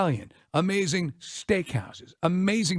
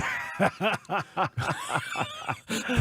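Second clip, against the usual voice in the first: quiet laugh through the words; breathy laugh; 3 semitones higher, 4 dB quieter than usual. Real, not acted. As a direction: chuckle mid-sentence. Laughing in the middle of the mock line.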